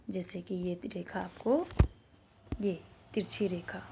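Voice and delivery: usual speaking voice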